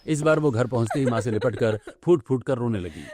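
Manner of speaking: usual speaking voice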